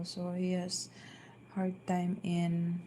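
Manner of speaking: usual speaking voice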